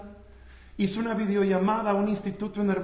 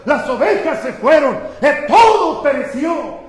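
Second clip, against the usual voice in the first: louder, building cry of disaster; urgent bad news; 9 dB louder than usual. Preacher retells a servant's report to Job, urgent. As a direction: report the losses with mounting force.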